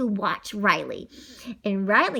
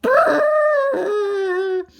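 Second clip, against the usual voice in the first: loud, high, drawn-out boo; scary boo; 8 semitones higher, 6 dB louder than usual. Acted. Blue goo creature startles the boy from behind, long loud boo. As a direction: jump out and spook someone.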